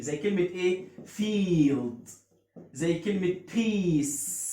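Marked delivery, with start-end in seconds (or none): 1.1-2.1 فيلد with a long, stretched "ee". Shown as a demonstration. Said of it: "إي بتعمل لونج إي". 3.5-4.4 بيس with a long, stretched "ee". Shown as a demonstration. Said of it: "الاي بتعمل لونج إي هنا".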